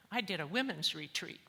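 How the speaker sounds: ordinary talk, nothing marked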